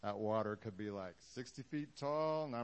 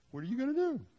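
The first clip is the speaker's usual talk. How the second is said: much higher, louder question; excited challenge; 9 dB louder, faster than usual. Real, not acted. Preacher challenging the congregation, high and loud. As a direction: fire the question at the room. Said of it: "rhetorical to congregation".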